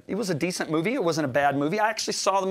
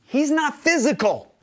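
higher, louder preaching push; fervent preaching; 9 semitones higher, 5 dB louder than usual. Acted. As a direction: drive it home from the pulpit. Preaching at full force.

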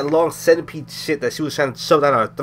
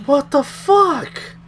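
slow, drawn-out and much higher; stunned disbelief; 13 semitones higher, 4 dB louder, much slower than usual. Real, not acted. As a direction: stretch it out in shocked disbelief. Stunned after the scare.

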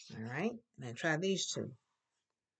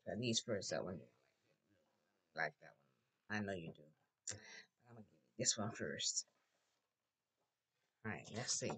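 quiet murmur; 10 semitones lower, 10 dB quieter, faster than usual. Real, not acted. Teacher murmurs while handing choices.

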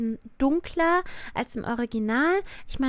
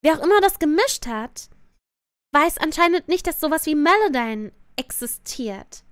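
loud, raised exasperated rant; 4 semitones higher, 5 dB louder than usual. Real, not acted. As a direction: vent your frustration. Ranting about the untuned vocals.

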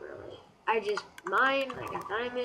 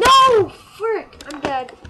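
sudden high furious shout; real gamer rage outburst; frustrated yell; 9 semitones higher, 15 dB louder, much slower than usual. Real, not acted. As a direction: scream it out as the game kills you. Dies in game.

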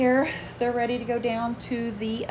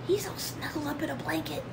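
whisper; 6 semitones higher, 5 dB quieter than usual. Real, not acted. Whispering about sleeping dog.

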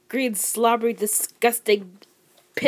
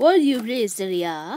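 put-on playful French accent, rising at the end. Asked to say it in French, mock French accent. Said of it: "Say it in French".

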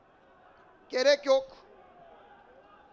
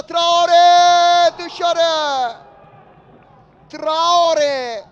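loud call of a missed chance; shouted near miss; 3 semitones higher, 10 dB louder, much slower than usual. Real, not acted.

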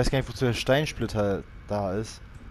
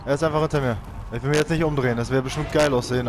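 ordinary talk, nothing marked

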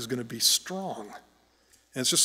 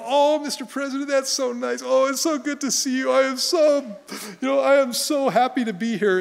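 high, loud flattering gush; mock gushing; 12 semitones higher, 8 dB louder than usual. Guests greeting the President in a receiving line, mock gushing. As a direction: exaggerate the guests' fawning delight.

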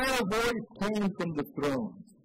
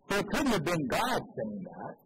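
usual speaking voice

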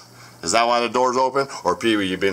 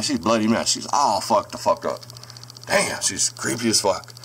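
normal talking voice

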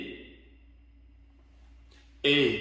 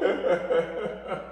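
chuckle; 3 semitones higher than usual. Real, not acted. Laughs at own slip.

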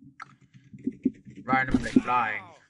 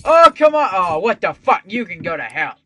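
furious yell; 3 semitones higher, 11 dB louder than usual. Real, not acted. Near win lost.

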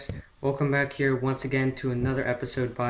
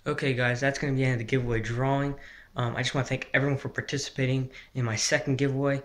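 normal talking voice

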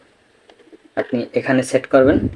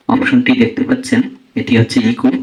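ordinary delivery

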